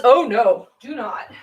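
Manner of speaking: ordinary delivery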